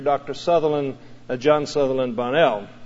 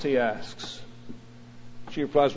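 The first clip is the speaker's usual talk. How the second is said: ordinary delivery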